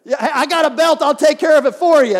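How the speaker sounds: high, loud churchy scold; mock outraged mom shouting about a running kid; imitating mom's scolding. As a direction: bark it like a fed-up mother offering her belt. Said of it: "she's gonna say".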